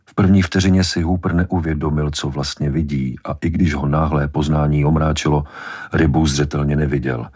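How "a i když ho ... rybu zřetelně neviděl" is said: ordinary delivery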